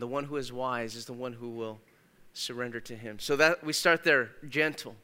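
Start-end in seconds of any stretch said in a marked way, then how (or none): none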